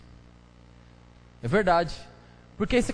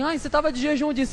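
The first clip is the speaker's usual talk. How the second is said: teasing busybody; 8 semitones higher, faster than usual. Retold or imitated. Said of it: "o outro já vem e fala assim".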